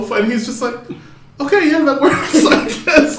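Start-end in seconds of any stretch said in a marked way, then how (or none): 1.4-3.2 high, laughing half-hearted agreement; a laughing imitation of a half-hearted okay; laughing mock reply. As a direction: shrug it off through a laugh.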